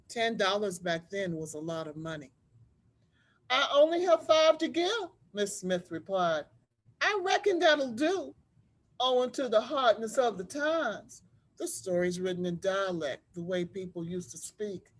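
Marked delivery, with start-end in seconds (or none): 0.1-11.2 put-on lively read-aloud voice for children; story narration. A narrator's reading. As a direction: tell it like a storybook to a class. Teacher reads picture book aloud.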